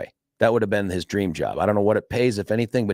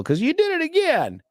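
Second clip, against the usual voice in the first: put-on much higher, sing-song teasing girl; student teasing her teacher, high mock voice; mock schoolgirl accusing. Student voiced in retold story.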